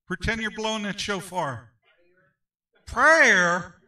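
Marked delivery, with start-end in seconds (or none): 2.9-3.7 put-on playful Southern accent echo, much louder; a loud Southern drawl on Prayer. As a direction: echo the answer in a Southern twang. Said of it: "He even put a little southern accent to that".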